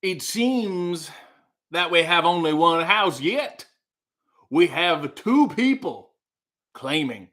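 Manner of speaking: lightly voiced courtroom lawyer, slow and grand. Lawyer addressing the jury on the house dispute, slow and grand. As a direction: address the jury like a pompous attorney, slowly. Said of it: "let me do the lawyer".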